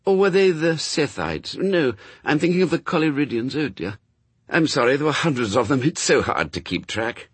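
high, flustered, apologetic angel; scholarly Aziraphale. A narrator's reading. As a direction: puzzle it out aloud, then apologise.

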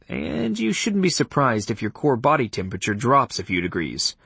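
usual speaking voice

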